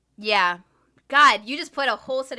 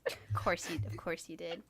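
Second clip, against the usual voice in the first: "Of course you did" whispered, much softer; breathy unvoiced whisper, much quieter; teasing whisper; 5 semitones lower, 12 dB quieter than usual. Real, not acted. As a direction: whisper the tease under your breath. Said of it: "but I was like".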